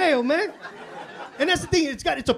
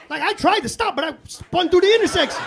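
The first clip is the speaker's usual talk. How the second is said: excited retelling; 6 semitones higher than usual. Retold or imitated. Retelling panic spin in snowstorm.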